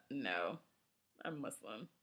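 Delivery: low, soft, embarrassed correction; sheepish correction. Acted. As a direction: step in softly after a little laugh. Narrator intervenes to correct the misunderstanding.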